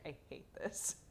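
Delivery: whispered grumpy cat voice; voicing the cat. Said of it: "He's like".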